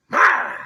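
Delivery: explosive loud wood-chopping yell; grandfather's roar. Retold or imitated. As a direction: imitate a huge roar as the axe swings. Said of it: "he yelled".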